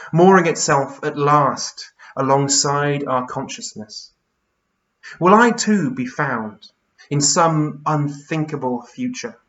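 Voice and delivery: measured verse with deliberate pauses; poetry reading. Acted. Poet wonders if they too will be found, reflective poetry reading. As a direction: linger on stressed words and let each line settle. Reads a second poem.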